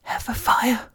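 a terrified hiss of a whisper; frightened whisper of recognition; fearful whisper. Acted. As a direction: breathe the word out in dread. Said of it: "whispered, his usually cocky voice filled with fear".

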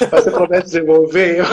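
light laugh, then a teasing ask; laughing playful request. Retold or imitated. [name] laughing as she asks her guest to return the shoes. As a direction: giggle through the promise, half joking. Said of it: "Porque a [name] falou assim".

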